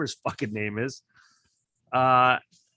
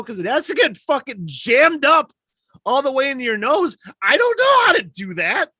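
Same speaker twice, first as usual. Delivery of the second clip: much higher and louder frantic panic; panic mode; 9 semitones higher, 5 dB louder than usual. Retold or imitated. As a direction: spiral into a loud anxious rant. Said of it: "stupid preemptive panic mode".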